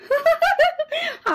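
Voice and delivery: a bright burst of laughter; laughing. Acted. Aunt laughing at the muddy stranger, amused laugh. আমারই তো হাসি পাচ্ছে.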